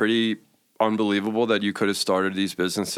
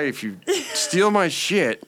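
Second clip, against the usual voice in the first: mock threat; 8 semitones higher than usual. Friend voiced as menacing threat.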